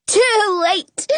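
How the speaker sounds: high gleeful cry; excited cry. A narrator's reading. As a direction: call it out high and eager. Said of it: "Jonah cries".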